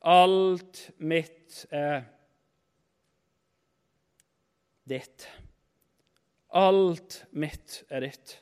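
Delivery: very slow, with long pauses between the words; deliberate, drawn-out solemn quote; slow and weighty. Retold or imitated. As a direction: let each word of the promise land. Preacher quotes father's key promise.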